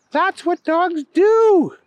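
put-on high, slow little girl retort; drawn-out high child voice. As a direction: protest high like a small girl, stretching it. Voices the girl Sushi arguing.

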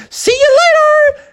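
very high, loud squeal; little sister yelling as she jumps; sister bear. Acted. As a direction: shriek it gleefully.

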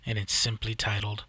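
usual speaking voice

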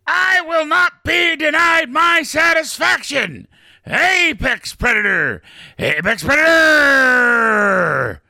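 high, loud, drawn-out roar; defiant shout. Acted. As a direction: roar it to the sky. Don's final defiant roar.

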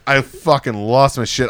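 ordinary talk

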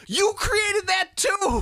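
high, loud, slow accusing yell; ranting shout. Real, not acted. Fan yelling at Tony Stark, high loud slow shout. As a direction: hurl the final accusation. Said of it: "my animation".

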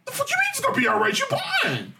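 high, fast outraged squeal; retold panic at the news, very high and fast; outraged disbelief. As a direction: voice jumps way up in stunned disbelief. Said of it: "I'm like".

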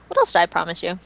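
usual speaking voice

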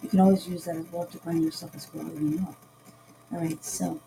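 ordinary talk

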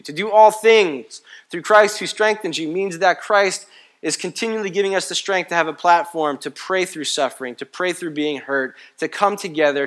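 loud, high, driving preacher's cadence; preaching at full force. Acted. Pastor rousing the congregation, full-force preaching. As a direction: hammer each not about home to the church.

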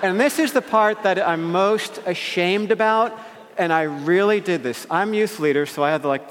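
ordinary delivery, nothing marked

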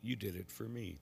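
measured, solemn proclamation; quiet liturgical reading; gospel reading. Acted. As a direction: read scripture aloud at the altar.

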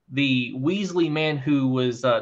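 ordinary delivery

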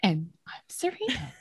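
mock your own hesitant hand-off, pausing inside "And I'm Serena"; imitated delayed intro. Co-host showing how she avoids talking over friends, with a delayed, subdued intro. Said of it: "talk over you guys by accident".

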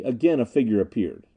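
ordinary delivery

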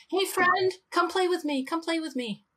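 high playful puppy voice; dog's voice. Retold or imitated. Owner voicing her barking dog, high and playful. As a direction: voice the dog begging to play. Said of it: "Probably saying".

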